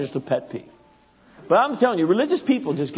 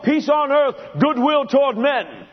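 high, loud and slow, like a pageant herald; Christmas play proclamation; 9 semitones higher, 5 dB louder than usual. Retold or imitated. Preacher mimics the Christmas play, loud and slow. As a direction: belt the line out like a child on stage.

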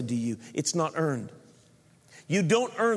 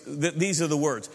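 usual speaking voice

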